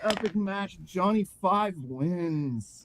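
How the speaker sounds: high, loud drawn-out cheer, settling into a slow result; wrestling announcer. Acted. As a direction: announce the winner with a big, stretched call. Game master announces the match winner.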